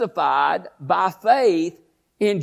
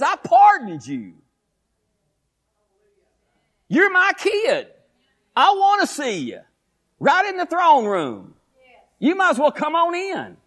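God's welcoming voice; 9 semitones higher than usual. Acted. Voicing God welcoming his child.

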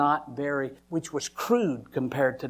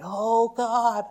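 high, loud anguished screaming, drawn out; full-force cry of breakdown; reenacted desperate scream; 11 semitones higher, 8 dB louder, slower than usual. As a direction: scream to God with everything in you. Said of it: "screaming out with everything that was in me".

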